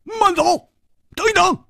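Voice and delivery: high, urgent shout to halt; loud shout. A narrator's reading. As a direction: call sharply after someone leaving. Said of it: "大喝道".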